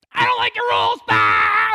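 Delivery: high, loud defiant yell; shouting. Retold or imitated. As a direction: shout it like a rebellious kid. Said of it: "they just say".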